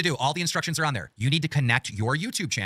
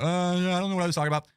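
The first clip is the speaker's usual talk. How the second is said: lightly mimicking a caller, pitched higher; mock clueless caller; 6 semitones higher than usual. Host mocks callers who forget their point, lightly imitated clueless voice. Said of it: "you're just like".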